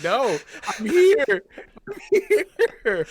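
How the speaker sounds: high, loud calling out "I'm here"; panicked shout. Retold or imitated. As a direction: yell into the void that you're still there. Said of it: "and I'm like".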